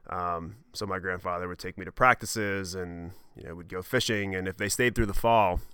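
ordinary talk

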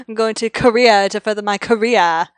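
loud Boston accent with a laugh in it; loud, amused try at a Boston accent; Boston accent that slips toward New York. Shown as a demonstration. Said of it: "let's see if I can do it".

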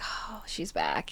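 slow, hushed whisper of awe; awed whisper. Retold or imitated. Fan reacting to the pop comeback, awed slow whisper. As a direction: whisper it slowly, as if awestruck.

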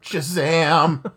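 loud laughing Shazam shout; exclaiming. Acted. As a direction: belt it out with a laugh. Shouted catchphrase.

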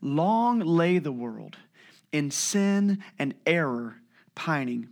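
slow, weighty quote with "Long" stressed; solemn slow recitation; slow quoted line. Shown as a demonstration. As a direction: draw out each word. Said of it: "He says".

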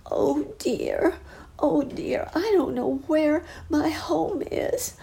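put-on high, whispered little boy's whimper; high, slow whispering boy; crying lost boy. A narrator's reading. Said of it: "heard a crying voice say".

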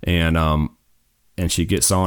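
ordinary talk, nothing marked

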